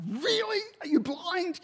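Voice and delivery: high, disbelieving retort; incredulous. Retold or imitated. Husband snapping back at wife, high incredulous. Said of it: "I go".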